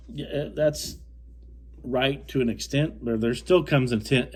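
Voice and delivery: usual speaking voice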